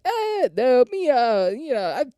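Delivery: high, hemming mock refusal; awkward reluctance. Acting out awkward refusal of coke.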